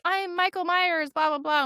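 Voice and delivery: mock scare attempt. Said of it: "she tried to scare us".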